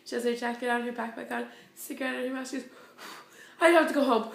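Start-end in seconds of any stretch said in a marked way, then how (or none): 3.6-4.3 loud mock declaration; dramatized, much louder exit line; imitated stressed announcement. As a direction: act out a fed-up helper quitting. Acts out stressed volunteer quitting.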